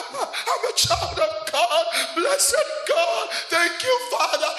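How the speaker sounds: loud, high sobbing proclamation; slow, full-voiced tearful shout to God; crying declaration. Shown as a demonstration. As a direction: wail the praise out through mock tears. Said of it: "go, go, go like this".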